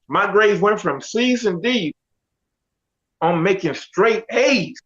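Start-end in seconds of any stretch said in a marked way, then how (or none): none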